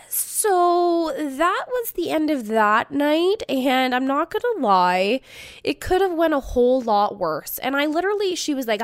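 plain reading voice

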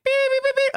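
high mock electronic beeps; beeping noise. Kids answering with a high robotic beep. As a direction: imitate the detector beeping. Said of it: "they were like".

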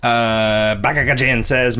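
ordinary talk

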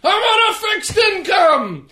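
high mock shouting old grump; hammed-up cranky old man with a laugh; grumpy old man yell. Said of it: "and then he goes".

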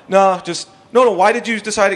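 high-pitched, flustered objection; panicked protest. Retold or imitated. Young comic losing his big break, panicked protest. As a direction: plead for a reason, flustered.

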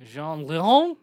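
"Jean Leon" in a loud, hammed-up French accent; amateur French accent, loud with a rising end. Shown as a demonstration. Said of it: "Say it with a French accent".

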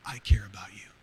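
God's whispered reassurance to a lonely man; gentle inner voice. Retold or imitated. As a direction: breathe it like a secret comfort. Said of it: "I would hear this voice".

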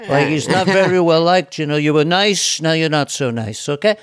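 lightly voiced rambling politician; a hint of the put-down artist at a podium; Donald Trump impression. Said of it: "define Donald Trump".

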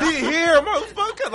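put-on high, loud, thrilled kid yell; laughing hype about Santa; excited shout. Kid hearing Santa on roof.

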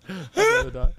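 high squeaky mock voice. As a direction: repeat it in a tiny high squeak. Repeated in a high voice.

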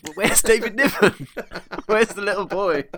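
high, loud, comically bewildered; baffled retold outburst. Telling a friend about finally seeing the film, mock bewilderment. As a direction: imitate your own astonishment, laughing through it.

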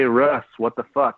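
usual speaking voice

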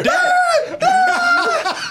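very high squealed Dad; shrieked imitation. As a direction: squeal it like a kid.

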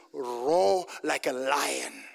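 slow, drawn-out command; retold command. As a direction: stretch it out as a solemn order.

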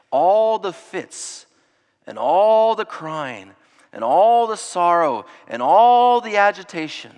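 slow, rising emphatic list, each all hit harder; building emphasis. Real, not acted. Preacher stressing the night's turmoil to his flock, slow and emphatic.

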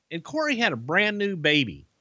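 ordinary talk, nothing marked

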